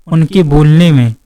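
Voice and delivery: usual speaking voice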